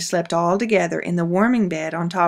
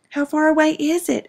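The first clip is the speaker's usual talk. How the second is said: put-on child's voice; 10 semitones higher than usual. Child asks mother.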